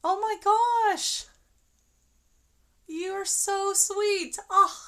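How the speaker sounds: high, slow, loud gasp of delight; overwhelmed by a kind surprise; real, moved gush of thanks; touched surprise. Real, not acted. Viewer gift surprises her live.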